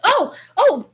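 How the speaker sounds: high, loud exclamations, imitating a scramble; flustered. Passenger fumbling for the camera, high flustered cries. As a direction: act out a sudden fluster.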